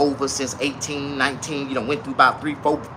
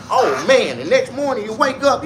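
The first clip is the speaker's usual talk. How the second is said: ordinary delivery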